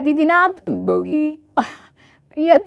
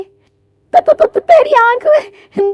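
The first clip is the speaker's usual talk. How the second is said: put-on high, slow old woman stammering over त- त- त- before तेरी आंख में; a lightly voiced elderly mother-in-law who stumbles on the first word; slow, high-pitched stutter on तेरी; 11 semitones higher, much slower than usual. Old woman stammers about eye.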